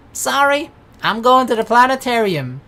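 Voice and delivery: loud, high gloating announcement; loud mock apology, high and triumphant; gleeful brush-off. Mock-brushing off Corona for the planetarium.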